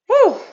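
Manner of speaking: high puffed-out whew after the set; real breathless whew. Real, not acted. A real exhale, high and out of breath. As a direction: instructor winded after mountain climbers, high whew. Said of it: "I'm out of breath".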